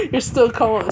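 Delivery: high, loud words through giggles; laughing. Acted. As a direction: giggle as you tease. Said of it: "just start laughing too".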